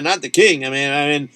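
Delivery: ordinary delivery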